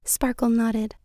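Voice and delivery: ordinary delivery, nothing marked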